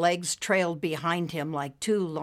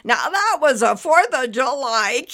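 loud, higher crowing voice; gleeful storyteller; 7 semitones higher, 7 dB louder than usual. A narrator's reading. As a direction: crow it proudly and loud. Junior crowing after his laugh.

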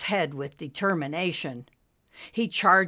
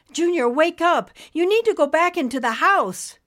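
high, loud, urgent pleading; raised voice, pitched high in alarm; frantic calling; 11 semitones higher, 6 dB louder than usual. A narrator's reading. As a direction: call out frantically to wake someone. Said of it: "Nimbus called frantically".

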